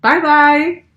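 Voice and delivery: happy loud goodbye to the viewers; cheerful goodbye. Real, not acted. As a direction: wave them off with a sing-song bye-bye. Cheerful sign-off at video end.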